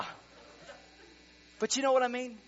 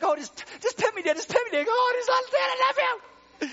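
much higher, loud pleading squeal; voiced dog begging; 11 dB louder, faster than usual. Pastor voicing his dog begging for pets, high pleading. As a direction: plead like a dog wanting petting. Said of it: "he's like".

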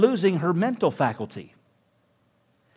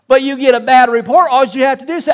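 louder, higher, driving sermon voice; full-force preaching; 7 semitones higher, 10 dB louder, faster than usual. Acted. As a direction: preach it out with rising fervor.

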